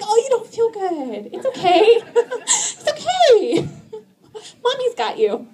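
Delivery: high, soothing, laughing coo; high laughing coo soothing a sick kid; comforting mommy voice. Retold or imitated.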